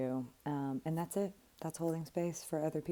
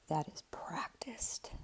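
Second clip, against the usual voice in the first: hushed emphasis; 7 dB quieter, slower than usual. Real, not acted. Stressing that skill takes practice.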